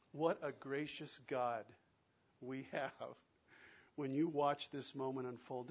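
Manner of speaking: ordinary talk, nothing marked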